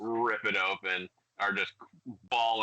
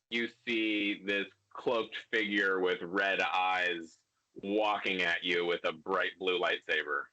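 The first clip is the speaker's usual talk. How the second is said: normal talking voice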